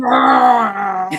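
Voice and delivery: shouted playful roar; dinosaur voice. Pretend. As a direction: jump out with a loud roaring "Bah!". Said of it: "you're gonna need to do that again".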